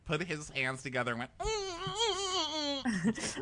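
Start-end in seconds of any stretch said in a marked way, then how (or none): 1.4-3.4 very high, drawn-out excited hums; mock squeal. He sees JoJo drive up, mock squealing. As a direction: squeal through closed lips, imitating giddy delight. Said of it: "He squealed when JoJo drove up".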